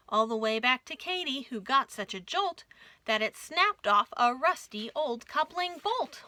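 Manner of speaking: plain reading voice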